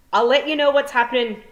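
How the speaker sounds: high, loud pixie cry. A narrator's reading. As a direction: call out as he sets off running. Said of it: "cried the pixie".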